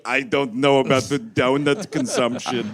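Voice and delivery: lightly voiced low, hesitant actor; a hint of low put-on reluctance; doubtful actor. Actor balking at the script, low mock doubt. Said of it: "but then was like".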